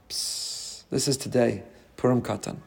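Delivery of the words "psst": ordinary delivery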